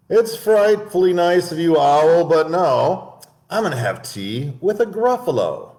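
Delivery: high, polite mouse voice; the clever mouse turns down lunch; tiny prim mouse; little mouse. Acted. Mouse answers owl.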